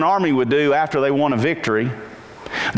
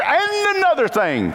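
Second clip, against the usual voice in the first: lecturing dad; 11 semitones higher than usual. Retold or imitated. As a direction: play a dad who won't stop lecturing. Dad's lecture voice again.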